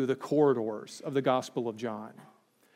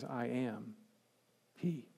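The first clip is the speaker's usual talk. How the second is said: softly, almost under the breath; hushed; 2 semitones lower, 11 dB quieter, slower than usual. Acted. Preacher quoting Jesus to the guards, hushed and soft. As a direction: let the words fall to a near hush.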